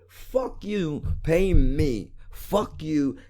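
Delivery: ordinary delivery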